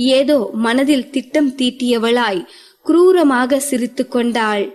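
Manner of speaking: plain reading voice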